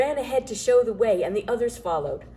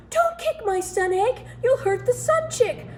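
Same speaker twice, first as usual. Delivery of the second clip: much higher pleading cry; distressed cry; 14 semitones higher, 3 dB louder than usual. A narrator's reading. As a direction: plead in alarm. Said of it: "cried the elf".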